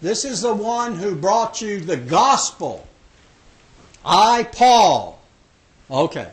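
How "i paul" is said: "I, Paul" said louder with weight on Paul; emphatic. Shown as a demonstration. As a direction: declare "I, Paul" firmly. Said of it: "wants everybody to know this is important".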